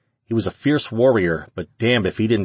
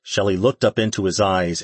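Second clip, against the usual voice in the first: ordinary delivery, nothing marked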